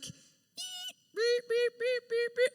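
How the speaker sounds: high, evenly spaced beeping; imitated reversing beeps. Plow starts backing up the driveway, high imitated warning beeps. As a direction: mimic a truck backing up. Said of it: "at a certain point we hear".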